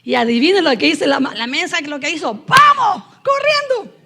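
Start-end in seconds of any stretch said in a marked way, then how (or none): 2.5-4.0 high, loud eager yell; retold excited cry of let's go; excited shout. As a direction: shout it like you can't wait to run. Retelling her own eager reply.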